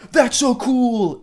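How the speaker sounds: high, drawn-out canned enthusiasm; mock overexcited game-character cheer; NPC exclamation. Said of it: "N P C dialogue".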